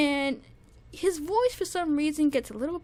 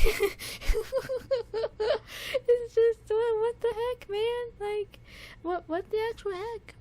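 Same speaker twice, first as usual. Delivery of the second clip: higher, half-laughing, half-crying disbelief; words cracking up high with amazement; overwhelmed disbelief; 8 semitones higher than usual. Real, not acted. Overwhelmed reacting to powerful chorus.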